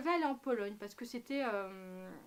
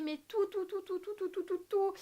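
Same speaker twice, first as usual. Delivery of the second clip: high, worked-up stream of tout; exasperated repetition; 7 semitones higher than usual. Acted. Telling viewers she was spoiled, an exasperated high rush of tout. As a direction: hammer each tout, higher and higher in disbelief. Ranting about being spoiled everything.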